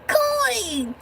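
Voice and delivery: very high squeaky leprechaun voice. Pretend. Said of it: "I sound like a leprechaun".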